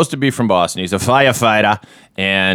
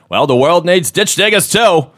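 put-on higher and louder, an overdone Boston accent; forced Boston accent; 10 semitones higher, 4 dB louder than usual. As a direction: ham up a fake Boston accent like a movie star. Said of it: "It just so forced".

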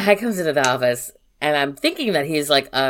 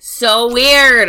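"so weird" pitched up and loud; emphatic disbelief; 8 semitones higher, 8 dB louder than usual. Real, not acted. Summing up the creepy visitor, "so weird" loud and drawn out. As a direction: hit "so weird" hard, stretching "weird".

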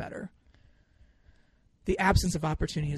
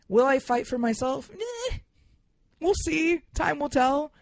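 wry doubt; 9 semitones higher, 3 dB louder than usual. Acted. Self-mocking doubt.

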